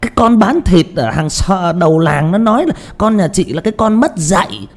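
meddling village gossip butting in; nosy neighbor cheering the parents on, high and loud. Shown as a demonstration. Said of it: "một cái thằng hàng xóm".

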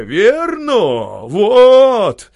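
high, loud crowd cheers; shouting approval. Acted. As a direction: yell your agreement from the crowd. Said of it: "подкрикивая".

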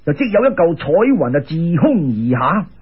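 ordinary delivery

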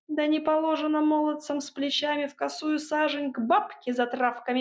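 high-pitched indignant crone's rebuke; mocking scolding witch. A narrator's reading. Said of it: "передразнила Баба Яга". (сварливая, насмешливая отповедь Яги)

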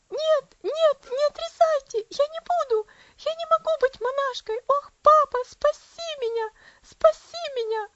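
put-on high, loud shrieking child; a feverish girl shrieking for her papa; piercing scream. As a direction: scream it out in panic. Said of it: "пронзительно кричала она".

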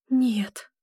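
ordinary delivery, nothing marked

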